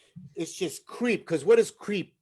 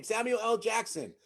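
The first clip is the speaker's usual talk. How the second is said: usual speaking voice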